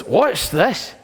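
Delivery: lightly voiced puzzled teenager with a laugh; mocking a bemused youngster; kid's puzzled question. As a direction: play the curious kid, amused. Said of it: "he goes".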